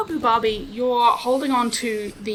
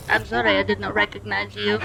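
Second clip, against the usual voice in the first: robot voice; 3 semitones lower than usual. Acted. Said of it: "Lifts up his flamethrower and goes".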